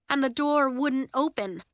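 plain reading voice

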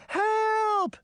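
very high, drawn-out cry; high-pitched panicked call; crying for help. A narrator's reading. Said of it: "Sabrina cried".

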